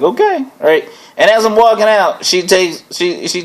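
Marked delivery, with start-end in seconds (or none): 0.0-0.4 much higher, innocent sing-song okay; high feigned-innocent okay; mock innocent okay. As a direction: play dumb with a high okay. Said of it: "And I was like".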